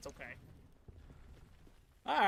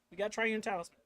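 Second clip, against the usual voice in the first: ordinary talk, nothing marked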